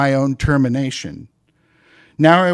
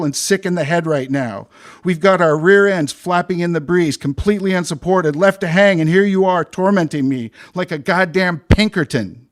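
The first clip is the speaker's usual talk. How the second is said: furious rant; 4 semitones higher than usual. Acted. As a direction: tear into the supervisor. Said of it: "I barked".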